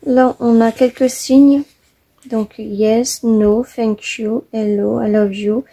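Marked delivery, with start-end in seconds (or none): 2.6-5.6 English words with a real French accent. Real, not acted. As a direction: read the English words one by one in your own French accent. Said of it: "mon anglais, hein, mon accent". (anglais avec un accent français)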